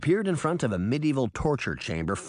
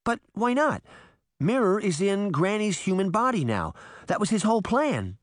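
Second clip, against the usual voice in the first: higher, urgent disbelief; anxious Sabrina; 9 semitones higher than usual. Acted. Girl protesting that Mirror's plan worked. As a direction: push back in worried confusion.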